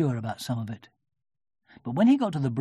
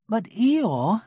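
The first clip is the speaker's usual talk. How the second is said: kindly Pooh voice; 8 semitones higher, 6 dB louder than usual. A narrator's reading. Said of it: "said Pooh".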